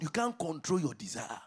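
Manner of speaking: ordinary talk, nothing marked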